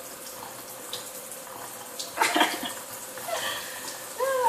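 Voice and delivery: long, quiet, breathy laugh; helpless wheezy laughing at the memory; real laugh. Real, not acted. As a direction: laugh it out softly, mostly air. Laughs at boyfriend's odd remark.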